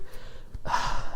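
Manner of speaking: long, heavy breath out; exasperated sigh. Real, not acted. Vlogger cooling off after being riled up, exasperated sigh. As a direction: let out a frustrated sigh. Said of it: "this riles me up".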